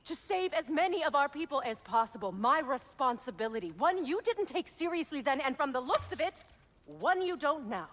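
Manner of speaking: furious sister scolding her brother; angry reproach. Acted. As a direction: throw his failure back at him. Heated quarrel with her brother.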